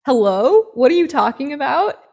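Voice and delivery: loud, high, incredulous comeback; baffled retort. Acted. Handyman answers Sam's sudden accusation, loudly baffled. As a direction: act out a bewildered man caught off guard.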